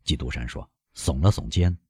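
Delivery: usual speaking voice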